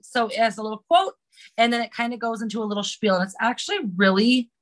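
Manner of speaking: ordinary talk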